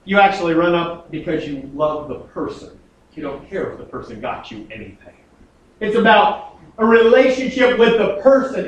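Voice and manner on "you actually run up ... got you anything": tender softening. Real, not acted. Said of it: "But when you get older".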